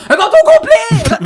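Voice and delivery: very high, loud, slow shriek; mother shrieking again. Retold or imitated. Mother calls back to yell at son again. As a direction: stretch it into a frantic wail. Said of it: "elle a recommencé à me crier après".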